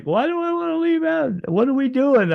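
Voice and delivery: very high-pitched comic whine; incredulous protest. Retold or imitated. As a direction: squeak out a baffled objection. Retold protest at leaving heaven.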